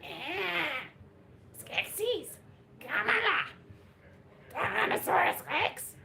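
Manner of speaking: one-size-fits-all bad impression voice; bad Trump impression. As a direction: do a deliberately terrible Trump that is also a T-Rex and a Skeksis. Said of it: "exactly like my Skeksis impression".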